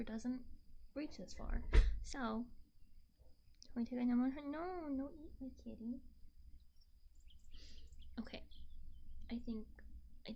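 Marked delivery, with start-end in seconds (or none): none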